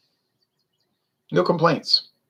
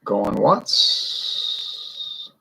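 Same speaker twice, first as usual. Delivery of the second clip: mock auctioneer; 4 dB quieter than usual. Auctioning off the beer cans.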